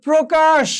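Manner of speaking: slow model of প্রকাশ with a clear o; o-ending প্র. Shown as a demonstration. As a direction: round the first vowel of প্রকাশ to "pro". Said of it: "ওকারান্ত হবে".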